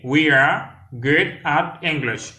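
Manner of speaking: slow, separated words for learners; slow demonstration. Shown as a demonstration. Teacher models an English phrase for learners, slowly. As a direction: model it word by word, unhurried.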